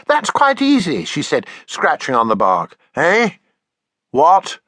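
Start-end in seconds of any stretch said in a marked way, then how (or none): 0.1-1.0 put-on high eager little girl; child voice. Taffy copying her father's drawing: high eager child voice. 3.0-4.6 sudden louder eh what; startled. Acted. Father disturbed while thinking: startled eh.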